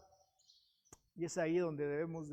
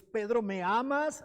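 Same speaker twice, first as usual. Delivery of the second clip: higher, slower and louder, pressing the question; pleading question; 5 semitones higher, 6 dB louder than usual. Acted. Jesus asks Peter a second time, slower and more urgent. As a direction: lean in and ask it more urgently.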